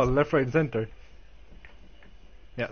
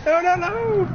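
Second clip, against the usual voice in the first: dismayed shout; slower than usual. Real, not acted. Dismay during race.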